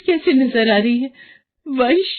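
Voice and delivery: sudden dread at the shirt; alarmed mother. Acted. Mother discovering her son's shirt in the machine, panicked.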